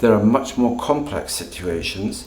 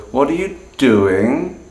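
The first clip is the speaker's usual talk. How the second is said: slow, doing dips down then rises again; fall rise tone; 5 semitones higher, 5 dB louder, slower than usual. Shown as a demonstration. Teacher demonstrating the fall rise tone. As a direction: scoop down and up on doing. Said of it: "you can hear that we go".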